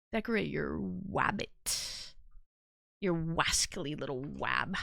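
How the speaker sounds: lisping cartoon hunter, low and slow; Elmer Fudd voice. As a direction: do the wascally wabbit lisp. Elmer Fudd style wabbit lisp.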